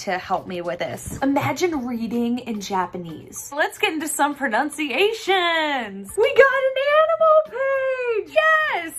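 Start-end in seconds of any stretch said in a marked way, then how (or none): 3.6-6.1 playful hype. Real, not acted. Hyped intro to next section. 6.2-8.9 very high, loud squeal of delight; excited squeal. Real, not acted. Delighted at finding animal page.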